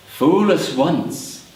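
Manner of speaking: slow, deliberate menace; ominous goblins. Acted. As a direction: draw out each word as a warning. Goblins catch the intruder, ominous.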